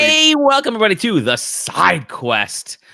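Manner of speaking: big high-pitched host greeting; show-opening welcome. Real, not acted. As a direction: kick off the show with a rising shout-out.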